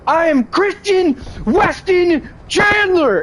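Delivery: high, slow, drawn-out mock declaration; imitating Chris Chan. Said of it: "All I heard was".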